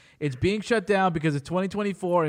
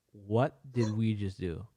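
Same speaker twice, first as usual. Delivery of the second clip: slow, low, softer retold disbelief; stunned astonishment; 6 semitones lower, 8 dB quieter, slower than usual. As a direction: say it slowly, as dumbstruck parents. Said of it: "laughing was just astonishment".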